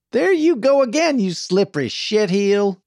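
loud, high-pitched insult; scornful yell; angry outburst. A narrator's reading. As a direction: cut him off with a heated accusation. She cuts Archie off angrily.

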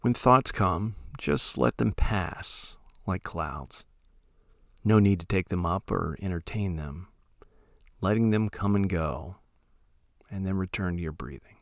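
soft, unhurried meditation voice with long pauses; calm, hushed instruction, letting each phrase trail into silence; guided meditation. Acted. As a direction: gently guide listeners into settling, phrase by phrase.

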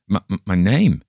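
put-on a frightened girl stammering. A narrator's reading. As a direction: trip over the first sounds. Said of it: "she stammered".

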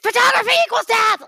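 high, loud chanted slogan; hammed-up protest chant; mock shouted slogan. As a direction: yell it like a protester. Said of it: "they would go".

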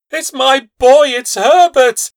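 high, loud, slow desperate cry. Acted. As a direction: cry out for her son. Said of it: "she cried".

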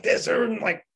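exasperated, high-pitched mock outrage; retold fury. Patient fuming as his lips get sponged, furious retold protest. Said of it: "furious 'cause I'm like".

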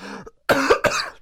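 sudden rough coughing fit; choking cough. Real, not acted. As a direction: cough hard on swallowed water. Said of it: "Choking on my water".

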